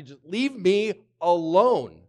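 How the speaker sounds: loud, high, drawn-out acted protest; hammed-up exasperated plea; defiant outburst. Acting out defiance toward pursuing God.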